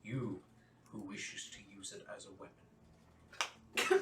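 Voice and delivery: lightly voiced solemn questioner; questioning ruler. Ruler questions the would-be weapon user, measured and grave. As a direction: pronounce it with slow, measured weight.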